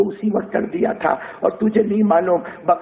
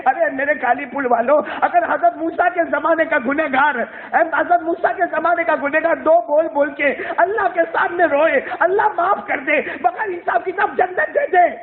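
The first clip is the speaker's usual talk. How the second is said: fervent preacher pleading; impassioned preaching; 8 semitones higher than usual. Acted. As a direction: press the point hard to the listeners. Preacher's climax.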